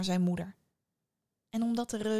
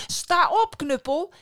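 higher, louder triumphant call; loud command; 10 semitones higher, 9 dB louder, slower than usual. Acted. Antoine loudly commands the club to rise. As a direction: shout it out proudly.

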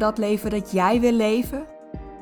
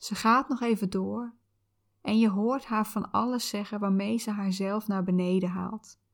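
calm soothing visualization guide; soft spa whisper; 3 dB quieter than usual. Acted.

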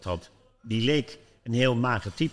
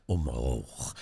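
a much lower, softer, drawn-out "omhoog"; hypnotist voice; 8 semitones lower, 3 dB quieter than usual. Retold or imitated. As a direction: sink the word down like a hypnotist. Speelt de hypnotiseur na. (lage, zachte hypnotiseursstem)

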